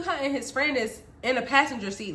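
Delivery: usual speaking voice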